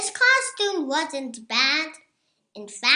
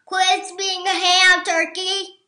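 higher, louder scold; teasing sheep; 6 semitones higher, 7 dB louder than usual. A narrator's reading. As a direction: call him out loudly. Sheep teases Turkey.

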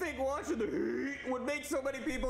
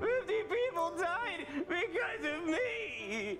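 slow, higher, mock-weepy wail; mock sobbing; 8 semitones higher, much slower than usual. Boynie wails to himself over the fifty dead, mock sobbing. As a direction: ham up the tearful guilt.

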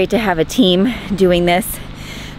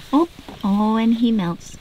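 ordinary talk, nothing marked

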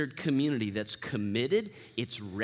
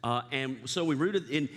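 ordinary delivery

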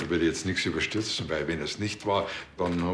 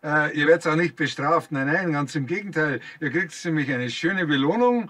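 higher, coaxing on-air voice; mock radio appeal; 9 semitones higher, 3 dB louder than usual. Policeman promising sprayers a reward on air, coaxing host voice. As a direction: lure them in like a friendly host.

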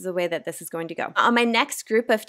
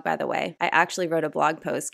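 usual speaking voice

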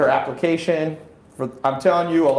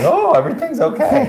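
high, laughing, sugary reassurance; cheery doctor; 13 semitones higher, 4 dB louder than usual. Pretend. Laughing doctor reassures patient about cancer, bright and high. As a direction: sing it out brightly like a doctor hiding bad news. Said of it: "would you rather them be like".

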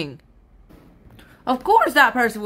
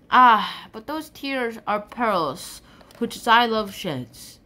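poem recitation; 5 dB louder than usual. Acted. Full recitation of the sonnet.

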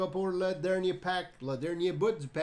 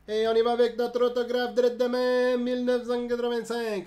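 much higher and louder, fast, half sung; excited singsong announcement; 7 semitones higher, 7 dB louder, faster than usual. Real, not acted. Streamer hyping the chat over an autograph card, excited singsong. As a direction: belt it out like a hype announcer. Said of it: "Non, je chante pas".

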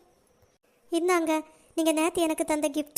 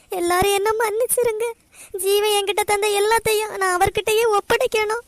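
higher-pitched, anguished begging for forgiveness; tearful pleading; 4 semitones higher than usual. Acted. Girl begging her family to forgive her, tearful pleading. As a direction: plead through sobs, voice raised in pitch.

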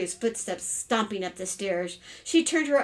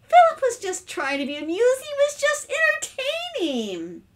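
high, louder amused protest; amused, laughing it off; 5 dB louder than usual. A narrator's reading. Mother excusing her son to her husband, amused and high. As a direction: make light of it in a high voice.

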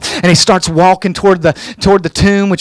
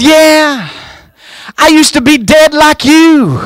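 high, raised punchline delivery; emphatic comeback; 7 semitones higher, slower than usual. Retold or imitated. Telling the preacher he used to be dead, emphatic comeback. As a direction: push the retort up high and draw it out.

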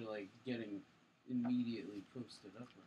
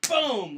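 loud, higher burst of "boom"; sudden "boom"; 10 semitones higher, 13 dB louder than usual. Retold or imitated. Teller mimics bolting under the fight, explosive boom. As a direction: punch out a booming sound effect.

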